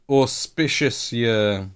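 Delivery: Auspicious stretched slowly; drawn-out word. Shown as a demonstration. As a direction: savour each syllable of auspicious. Tries the word twice.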